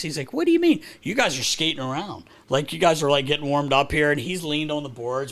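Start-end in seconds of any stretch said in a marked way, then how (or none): 0.3-4.1 higher, louder indignant whine; imitating Phil. Broadcaster imitating Phil answering his chirp. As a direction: play it offended and puzzled. Phil retold defensive reply.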